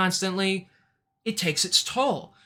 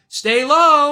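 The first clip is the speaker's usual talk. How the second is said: high, loud imitated call; host calling out; 7 semitones higher, 8 dB louder than usual. Host warning a player crawling through mud, calling out. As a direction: call it out as a shouted caution. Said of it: "you hear Jeff going".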